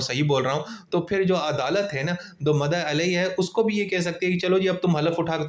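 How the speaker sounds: ordinary talk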